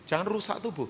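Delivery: ordinary delivery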